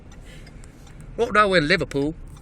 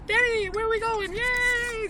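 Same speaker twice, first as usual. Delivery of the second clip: put-on much higher, squeaky little kid; child voice; 3 dB quieter, slower than usual. Dad voicing little June from the back seat, high child voice. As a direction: play the small daughter asking. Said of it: "This is June".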